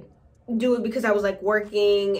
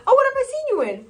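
high mock asker; 13 semitones higher, 4 dB louder, much faster than usual. Mock asker in retold chat.

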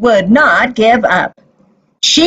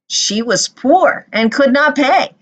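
usual speaking voice

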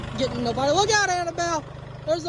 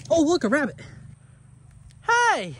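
normal talking voice

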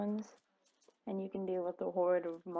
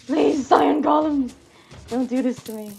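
loud pleading; 4 semitones higher, 13 dB louder than usual. Real, not acted. Gamer begging the golems for mercy, loud pleading. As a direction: beg at full volume.

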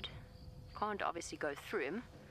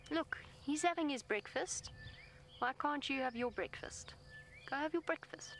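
much higher, sing-song coaxing; 9 semitones higher than usual. Real, not acted. Guide coaxes the elephant to go eat, high sing-song voice. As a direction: nudge him gently toward breakfast.

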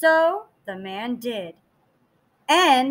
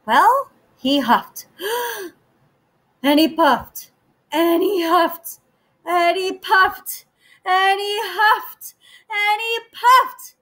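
rising higher with each huff; building excitement; 7 semitones higher than usual. A narrator's reading. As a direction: build the tension line by line. Rising tension in the story.

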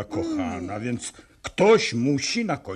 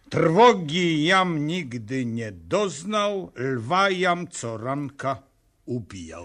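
slow, measured, pompous verse reading; declaiming blank verse; 4 dB louder, slower than usual. Shown as a demonstration. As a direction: recite it like grand tragedy, slowly. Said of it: "No ujdzie".

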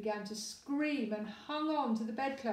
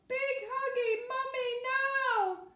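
put-on weeping baby; 5 dB louder than usual. Baby carried out, tearfully demanding mummy. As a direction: whine for mummy as you're carried out. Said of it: "wept the baby".

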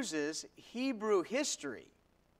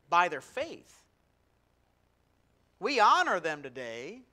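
louder, rising sermon build; preaching; 5 dB louder, slower than usual. Acted. Pastor building to a climax for the congregation, preaching louder. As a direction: call out each name with growing force.

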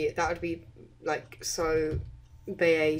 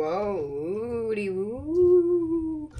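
slow, high wavering woo; wobbly voice vibration; 3 semitones higher, much slower than usual. Pretend. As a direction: stretch it out with a trembling wobble. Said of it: "don't know why I'm doing the voice vibration".

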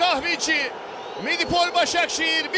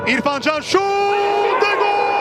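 screaming football commentator; 4 dB louder than usual. Real, not acted. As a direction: stretch the goal call out loud. Goal call in live commentary.

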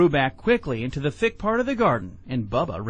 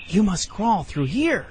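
lightly voiced snake, slow and higher; coaxing snake; 5 semitones higher than usual. A narrator's reading. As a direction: coax a small child along slowly. Snake luring the child on.

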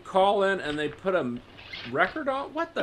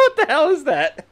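high, laughing, louder what the hell; laughing disbelief; 7 dB louder than usual. Real, not acted. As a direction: crack up as you ask it. Mocking strange phone scene.

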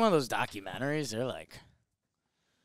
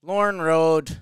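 street name with an Irish lilt; Irish-sounding read; 4 semitones higher, 8 dB louder than usual. Real, not acted. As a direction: read the sign out with an Irish twist. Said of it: "Oh, I said that Irish".

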